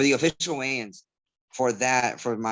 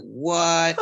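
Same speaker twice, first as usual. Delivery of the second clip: higher, drawn-out mock disbelief; imitated baffled crowd; 4 semitones higher, 4 dB louder than usual. Fighter imitating fans baffled by his Spanish shout, mock astonished. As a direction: react as a whole crowd taken aback. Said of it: "everybody's looking at me like".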